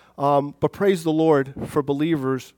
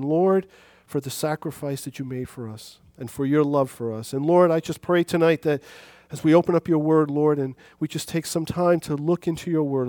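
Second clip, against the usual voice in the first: prayer; 4 semitones lower than usual. Acted. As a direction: pray gently and reverently. Leads a prayer.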